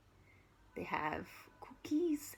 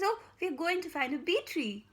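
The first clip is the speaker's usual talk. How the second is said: put-on higher, eager child's voice; 6 semitones higher, 4 dB louder than usual. Storyteller voicing Ellen telling about the bee tree, higher. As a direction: answer like an excited little girl.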